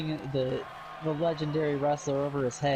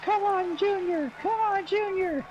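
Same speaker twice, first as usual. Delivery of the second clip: high excited cheering; wrestling commentator; 4 dB louder, slower than usual. Real, not acted. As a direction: shout him on, much higher and louder. Cheering wrestler to fight back.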